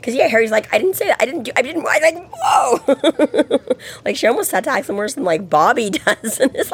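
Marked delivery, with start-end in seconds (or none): 0.7-3.6 high-pitched, stammering backpedal; mock flustered protest. Acting out Harry's flustered protest.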